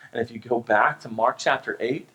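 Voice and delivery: ordinary talk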